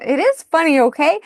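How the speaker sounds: laughing it off out loud; laughing at herself. Real, not acted. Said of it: "I laugh at inappropriate things".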